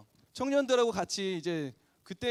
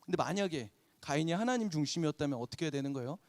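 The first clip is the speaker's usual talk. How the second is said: usual speaking voice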